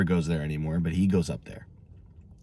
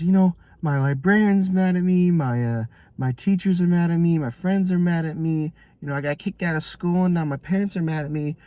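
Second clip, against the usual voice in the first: put-on upset kid; 9 semitones higher than usual. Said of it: "he just starts crying".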